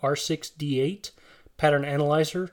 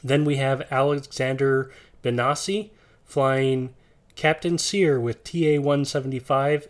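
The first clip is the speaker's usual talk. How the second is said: usual speaking voice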